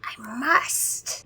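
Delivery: high, whispery vampire desperation; theatrical whispered vampire worry; muttering vampire. A narrator's reading. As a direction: fret to yourself, slowing into urgency. Vampire muttering frantically to himself.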